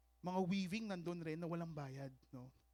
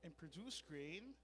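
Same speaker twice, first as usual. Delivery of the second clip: scripture reading; 2 semitones lower, 16 dB quieter than usual. Real, not acted. Pastor reading the parable with the congregation, hushed scripture reading. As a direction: read scripture softly, phrase by phrase.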